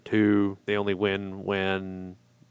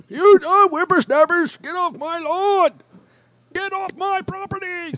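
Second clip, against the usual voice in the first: put-on high, loud cranky old-timer; old prospector; 6 dB louder than usual. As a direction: shriek it like a crotchety old geezer. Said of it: "Did he tell you to get off his lawn?".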